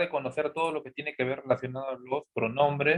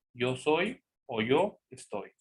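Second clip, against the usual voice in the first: ordinary talk, nothing marked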